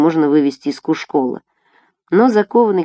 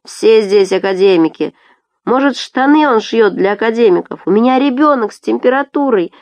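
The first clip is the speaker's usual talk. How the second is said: high, raised protest; indignant heckling; 5 semitones higher, 4 dB louder than usual. A narrator's reading. Tourists arguing with Murat, indignant. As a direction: heckle the official from the crowd. Crowd answering Murat back.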